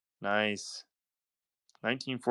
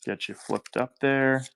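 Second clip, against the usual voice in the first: usual speaking voice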